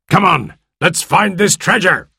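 high, urgent rallying cry; rally the crew to the hunt. A narrator's reading. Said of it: "cried Silver".

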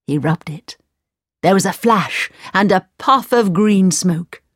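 usual speaking voice

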